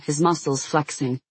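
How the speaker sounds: ordinary delivery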